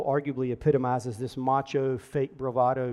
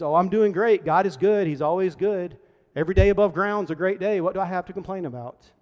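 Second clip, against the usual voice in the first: loud, higher mock-upbeat denial; forced cheerfulness; 4 semitones higher, 6 dB louder than usual. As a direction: brush trouble off with big, breezy positivity. Acting out a falsely upbeat phrase.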